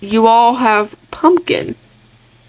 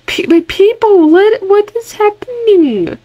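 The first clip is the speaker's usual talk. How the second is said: alarmed disbelief; 10 semitones higher than usual. Real, not acted. Player asking what is happening, high alarmed disbelief. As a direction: wail it high, totally baffled. Startled by events in game.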